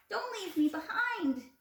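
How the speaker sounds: high, desperate plea; pleading. A narrator's reading. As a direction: beg them not to leave. Coyote pleading, after crying out.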